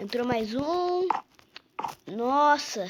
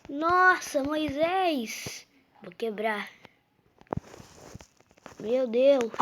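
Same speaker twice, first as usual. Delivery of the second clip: ordinary delivery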